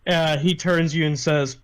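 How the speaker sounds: ordinary talk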